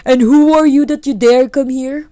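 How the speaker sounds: loud, high-pitched demand; indignant challenge. Acted. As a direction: challenge the intruder sharply. Women challenge the intruder.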